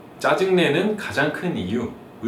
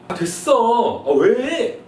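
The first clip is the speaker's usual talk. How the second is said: irritated son; 9 semitones higher, 4 dB louder, much slower than usual. Acted. Son's reply in skit.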